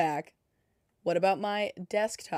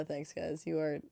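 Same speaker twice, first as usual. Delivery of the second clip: ordinary talk, nothing marked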